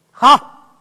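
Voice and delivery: ordinary delivery, nothing marked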